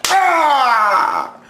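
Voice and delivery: high pretend yell at the girl; hammed-up shout; mock shout. Yelling at the girl.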